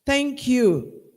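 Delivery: ordinary talk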